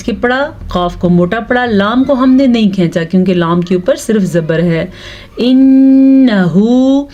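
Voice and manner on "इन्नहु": इन्नहु with the nn held long through the nose; nasal ghunna. Shown as a demonstration. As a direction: hum the नून of इन्नहु. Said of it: "नून का गुन्ना हमने अदा किया". (गुन्ने के साथ)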